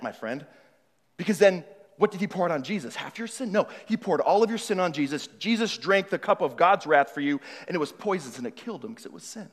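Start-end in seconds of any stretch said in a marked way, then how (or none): none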